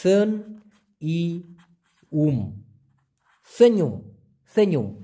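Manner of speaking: সেn, ই, উম split then joined as সেnyum; syllable by syllable. Shown as a demonstration. Teacher sounding out the Malay word piece by piece. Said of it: "উচ্চারণটা হবে".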